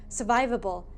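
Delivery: ordinary delivery, nothing marked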